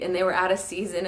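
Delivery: normal talking voice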